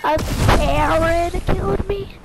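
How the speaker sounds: high, loud outcry at getting killed; real loud gamer outrage; loud complaint. Real, not acted. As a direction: cry out loudly, stretching the words. Angry reaction to being killed.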